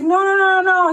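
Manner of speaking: mock-insistent denial; insistent refusal. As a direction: mimic a man brushing her off. Man insisting it's his coffee.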